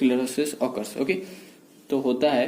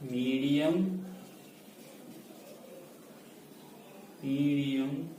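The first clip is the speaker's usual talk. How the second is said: slow dictation; 11 dB quieter, much slower than usual. Shown as a demonstration. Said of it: "So we should write".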